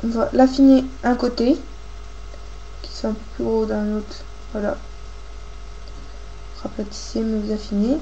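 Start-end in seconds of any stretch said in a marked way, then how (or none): none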